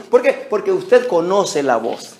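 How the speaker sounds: ordinary talk, nothing marked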